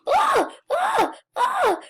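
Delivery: short, high-pitched screams; repeated squeals of fright; high frightened shrieks. Real, not acted. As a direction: shriek in bursts as if chased. Chased by the killer.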